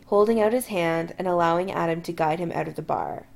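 ordinary delivery

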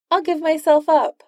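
high, breezy cheerfulness; cheerful. A narrator's reading. Tony surrendering to Doom, high and cheerful. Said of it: "said Tony cheerfully".

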